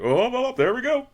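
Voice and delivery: high, loud gleeful goose voice. Retold or imitated. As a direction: squeal it out like a cheeky goose. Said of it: "pictured my goose voice".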